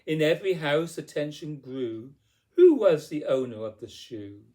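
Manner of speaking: ordinary delivery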